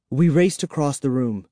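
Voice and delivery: ordinary delivery, nothing marked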